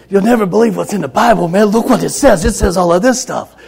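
loud, high, rapid-fire amazed blurting; excited disbelief. Retold or imitated. Boy tells teacher what's in the Bible, wildly excited. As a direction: shout it like an astonished, overexcited kid. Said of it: "the kid's excited and he can't believe it".

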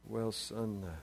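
very soft, low, hesitant mumble; King David fumbles answering son about meeting Mom; embarrassed mumble. Retold or imitated. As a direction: mumble it like a dad caught out. David stalls again.

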